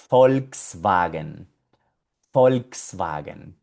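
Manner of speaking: "Volkswagen" slowly, V as F, W as V. Shown as a demonstration. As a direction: spell out "Volkswagen" by sound: folks-vah-gen. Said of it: "la B corta que suena como una F".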